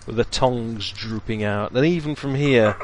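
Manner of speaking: slow, hushed scene-setting narration; game master scene setting. A narrator's reading. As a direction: paint the gloomy crypt slowly. GM describes the crypt scene.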